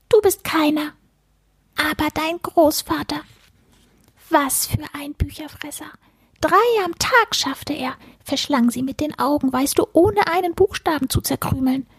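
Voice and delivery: high squeaky creature, admiring; small animal voice full of admiration; furry book-eater. A narrator's reading. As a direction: marvel as a tiny furball. Creature praises grandfather.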